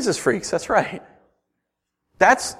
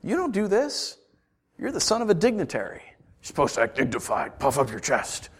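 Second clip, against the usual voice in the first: 1 hushed onlookers; 9 dB quieter than usual. Retold or imitated. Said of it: "And everyone's like".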